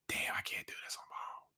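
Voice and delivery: hushed inner voice; whispered thought. Retold or imitated. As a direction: whisper it like a private realisation. Quoting his own thought.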